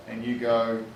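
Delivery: usual speaking voice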